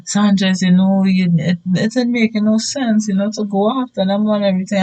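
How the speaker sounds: imitated teacher's appeal; reasoning teacher. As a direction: talk a student down in a light high voice. Voices teachers talking him down.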